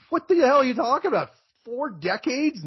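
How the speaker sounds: what-are-you-talking-about outburst; incredulous. Real, not acted. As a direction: blurt it in shocked disbelief.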